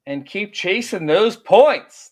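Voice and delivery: loud, slow, high rallying send-off; hype sign-off. Real, not acted. Host signing off to listeners, loud slow hype. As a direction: pump up the listeners.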